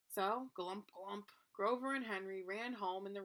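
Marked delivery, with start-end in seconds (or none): none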